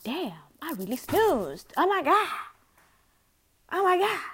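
high, loud disbelief; shocked. Retold or imitated. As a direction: gasp out the shock. Retold waking shock.

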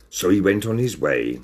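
ordinary delivery

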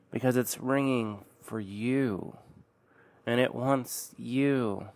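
slow, soft menacing threat; ominous hush. Acted. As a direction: lean in and menace quietly.

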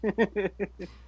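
words through loud laughter; cracking up while talking; laughing. Real, not acted. Laughing while voicing tantrum.

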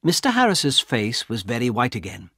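usual speaking voice

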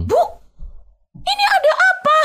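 very high-pitched acted confrontation; retold angry caller. Angry woman on the phone confronting the interviewer, high-pitched retold scolding. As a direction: demand it in a high, agitated mock voice. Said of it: "Dimaki sama orang yang ngangkat telepon itu".

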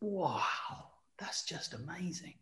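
hushed, whispered wonder; awed whisper. Real, not acted. Reacting to the Baby Yoda news, awed whisper. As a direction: whisper it slowly, as if amazed.

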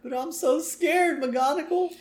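put-on very high, slow frightened kid voice; hammed-up high-pitched fear; scared little boy. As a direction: play a timid child in high falsetto. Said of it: "A little sissy boy who's too afraid".

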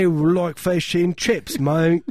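high, slow, hammed-up English accent; mock English accent. Tourist in England showing off, hammed-up English accent. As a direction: lay on a terrible English accent thick. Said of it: "Just do the accent".

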